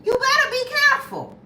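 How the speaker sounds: high, loud, sing-song warning; defiant comeback. Pretend. Telling the husband off about menopause, high loud sassy warning. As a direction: tease him back with a bold threat. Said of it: "I would've told him".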